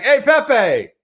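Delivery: high, loud call up the hill. Retold or imitated. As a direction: call up to the lion on the hilltop. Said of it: "he yells up to Pepe".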